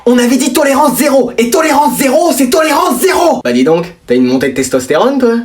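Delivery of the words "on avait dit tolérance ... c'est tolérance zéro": stern shout. Acted. Father to mother about the son sneaking out, stern shout. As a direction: bark the rule out at full force.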